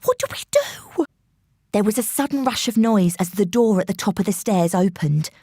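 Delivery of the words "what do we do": high frightened cry, near tears; a scared girl pleading for help; panicked cry. A narrator's reading.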